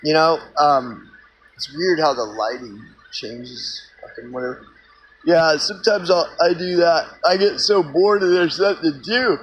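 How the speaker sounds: talking with a cigarette in mouth. Real, not acted. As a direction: speak around a lit cigarette. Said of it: "let me light my cigarette first".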